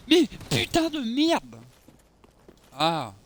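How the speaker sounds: long, slow, high furious yell; raging gamer. Real, not acted. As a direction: drag out the curse in rage. Another death in game.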